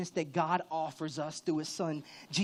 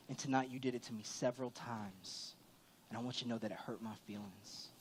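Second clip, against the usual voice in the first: soft, low and tender; lightly voiced gentle wife, speaking softly; wife's gentle words; 6 semitones lower, 10 dB quieter than usual. Wife telling pastor he hurt her, soft and low. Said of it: "And she said".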